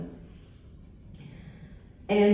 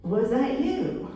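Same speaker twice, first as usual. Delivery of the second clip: higher, drawn-out question rising at the end; curious wondering; 6 semitones higher, slower than usual. Retold or imitated. Woman asking the Lord about an invitation, curious and wondering. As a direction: wonder it aloud, voice lifting. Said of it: "with all curiosity".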